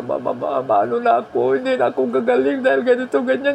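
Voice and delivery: high, fast whining complaint; whiny patient. Retold or imitated. Sick man whining to his mother in a high fast voice. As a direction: whine to mama like a scared patient.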